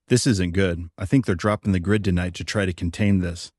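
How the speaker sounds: plain reading voice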